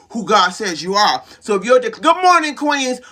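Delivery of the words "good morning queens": high, loud wake-up greeting; calling out. Real, not acted. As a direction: hail the listeners with a raised voice. Breaks off to hail listeners.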